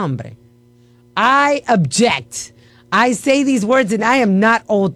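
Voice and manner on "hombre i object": mock objection.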